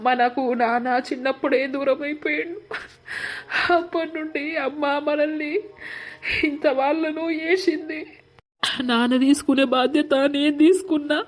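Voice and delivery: crying. Acted. Brother thanking his sister through building tears. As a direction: voice rising and breaking as the tears build. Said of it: "ఎందుకు ఎడుతున్నావు చెప్పు?".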